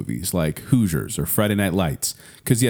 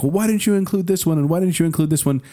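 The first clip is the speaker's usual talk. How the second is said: higher-pitched mock complaint; complaining listeners; 7 semitones higher than usual. Imitating people questioning the movie list, higher-pitched complaint. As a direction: pester with a high, repeated question. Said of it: "people being like".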